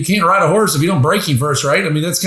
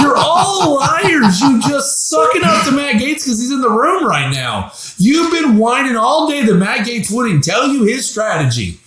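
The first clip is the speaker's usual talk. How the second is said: mock outraged shout; 8 semitones higher than usual. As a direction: yell at the hypocrites in half-joking outrage. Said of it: "And it's like".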